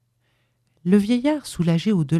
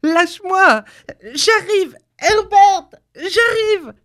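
very high, loud frantic cries; shrieking mother in short bursts; struggling shouts; 5 dB louder, slower than usual. A narrator's reading. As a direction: call out desperately while breaking free. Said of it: "en continuant de se débattre".

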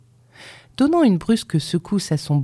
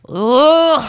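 loud, high outraged "oh"; furious cry; 12 semitones higher, 8 dB louder than usual. A narrator's reading. Grandmother outraged at the boy's excuse, crying furiously. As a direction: explode in one indignant cry. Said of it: "cria-t-elle furieuse".

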